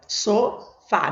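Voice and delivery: "sofá" slowly split into two syllables. Shown as a demonstration. Teacher counting syllables for students, "sofá" slowly split. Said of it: "Eu começo a contar aqui".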